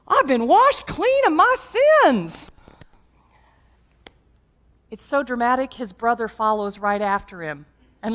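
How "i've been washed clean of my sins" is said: high, loud, drawn-out hollering; hammed-up jubilant yell; exultant shout. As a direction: imitate a new convert crying out in joy. Del Mar exults after his baptism.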